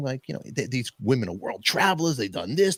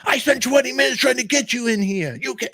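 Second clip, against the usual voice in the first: high, loud exasperated tirade; imitated furious maître d'; retold yelling; 13 semitones higher, 6 dB louder than usual. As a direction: yell it at a departing customer. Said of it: "everybody's yelling".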